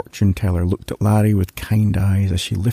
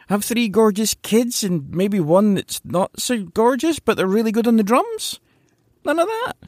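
higher, quick, incredulous rant; indignant protest; 12 semitones higher than usual. Acted. Larry protesting to the fortune teller, high and incredulous. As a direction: rattle off the complaint in disbelief.

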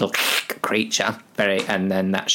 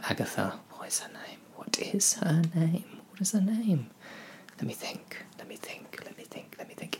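a fading whisper, dropping to barely audible; whispering to self; 9 semitones higher, 10 dB quieter than usual. Real, not acted. Storyteller trying to recall the elf's name, whispering. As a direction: whisper it over and over while thinking.

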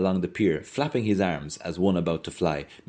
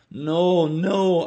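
excited protest; 11 semitones higher, 5 dB louder than usual. Acted. Mulligan's loud protest.

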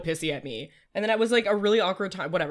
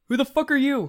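sharp, higher and louder; hostile demand; 8 semitones higher, 6 dB louder than usual. Acted. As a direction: snap at the stranger. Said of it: "I'm gonna be like".

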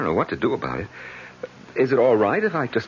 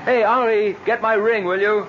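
calling out; 11 semitones higher, 4 dB louder than usual. Acted. Calling out to Henri outside.

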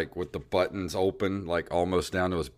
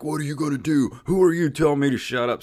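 heckler snapping back; 8 semitones higher, faster than usual. Retold or imitated.

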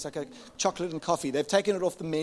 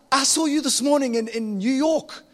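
shouted high and loud; loud, high, thrilled yell; excited shout; 9 semitones higher, 10 dB louder than usual. Retold or imitated. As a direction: call out across the room in delighted disbelief. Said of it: "I just shout".

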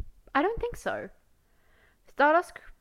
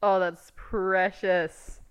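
tender; 5 dB louder, much slower than usual. Real, not acted. Cooing at the photo.

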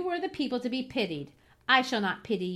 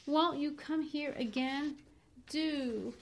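higher, gentle pleading; more kindly; 6 semitones higher than usual. Acted. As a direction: soften and implore him tenderly. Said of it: "she continued more kindly".

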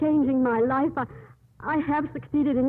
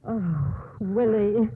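crying; 2 semitones lower, much slower than usual. Acted. Sister dismayed at Willie's outburst.

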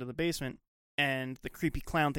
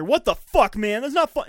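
higher, louder startled yelling; retold angry yell; 9 semitones higher, 10 dB louder than usual. Tony yells at Paul for scaring him, loud and higher. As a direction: yell it like you just got scared.

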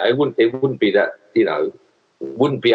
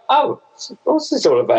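taken aback; 12 semitones higher than usual. Retold or imitated. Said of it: "you know, like".

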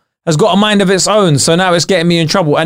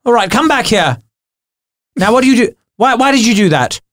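put-on higher, fussing father telling off a toddler; stern dad scolding; 6 semitones higher than usual. Dad telling his wandering arm Oscar off, high and stern.

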